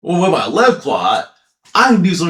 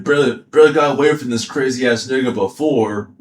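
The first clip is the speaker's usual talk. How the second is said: ordinary talk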